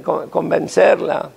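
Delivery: ordinary delivery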